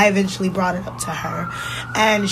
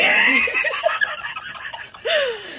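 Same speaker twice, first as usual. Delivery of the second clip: big laugh. Real, not acted. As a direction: crack up at your own story. Laughing at own car pep talk.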